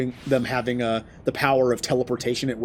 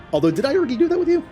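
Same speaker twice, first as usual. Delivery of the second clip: high, fast sudden realization; surprised; 13 semitones higher, 5 dB louder, much faster than usual. Real, not acted. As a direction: blurt it out in a rush.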